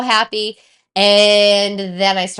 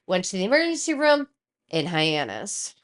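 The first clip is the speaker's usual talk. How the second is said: normal talking voice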